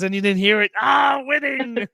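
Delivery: loud, high gleeful cry of winning; triumphant shout. Real, not acted. Dad jokingly celebrating his own bad choices, loud. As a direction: crow about winning.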